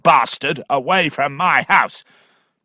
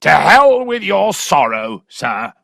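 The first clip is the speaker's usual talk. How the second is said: high loud snarl of contempt; furious squire; 6 semitones higher, 6 dB louder than usual. Acted. Angry father dismissing Allworthy's sorrow, furious. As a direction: spit out the curse. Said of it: "cried Allworthy".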